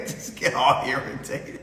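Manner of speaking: normal talking voice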